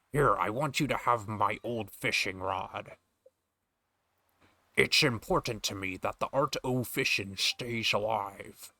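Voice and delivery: slow, hammy Scottish sailor; over-voiced Connery fisherman; Sean Connery voice. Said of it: "I'm already over voicing this guy".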